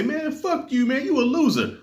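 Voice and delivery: high-pitched mock kid insult; bratty kid. Voices movie kids insulting [name].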